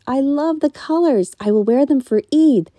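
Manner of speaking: put-on happy girl; excited daughter. A narrator's reading. Daughter delighted.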